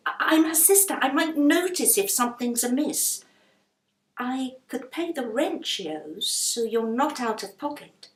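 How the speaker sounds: pressing her case; a touch of urgency; persuading Rose. Acted.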